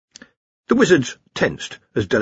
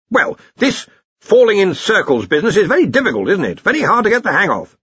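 higher-pitched, flustered excuse; defensive dean; 10 semitones higher, 4 dB louder than usual. A narrator's reading. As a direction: make excuses, a bit put out.

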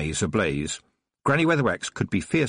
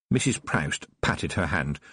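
ordinary delivery, nothing marked

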